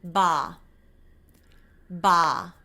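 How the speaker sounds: Ba with lips pressed in; English B. Shown as a demonstration. Teacher modelling the English B to copy. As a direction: say Ba with tense lips. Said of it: "Say it with me".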